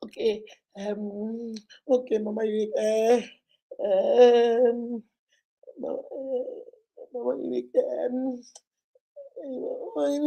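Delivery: falter, then blurt the love out; tearful confession. Retold or imitated. Client confessing to Mama Unique she loves him, tearful whisper to cry.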